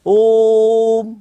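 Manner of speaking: ओ तीन मात्रा तक खींचकर; ओम को तिगुना लंबा बोलें; प्लुत ओम. Shown as a demonstration. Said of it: "जितना इसको तीन बार बोला जाता है". (ओ को तीन गुना खींचकर ओम)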